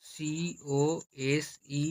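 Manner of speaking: সিওএসই spelled letter by letter, very slowly; spelling out. Shown as a demonstration. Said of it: "সম্পূর্ণ উচ্চারণ করে cosent".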